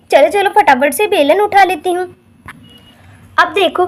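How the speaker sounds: usual speaking voice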